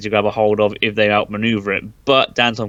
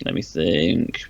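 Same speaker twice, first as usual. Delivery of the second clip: slow pondering; 7 semitones lower, 6 dB quieter, much slower than usual. Acted. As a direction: let me think, slow, lower and softer, drawn out. Drawn-out pondering.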